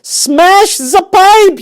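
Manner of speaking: very high, loud, slow yell; a mother yelling to free her stuck son. Acted. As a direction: bellow the order. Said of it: "yelled Missus Gloop".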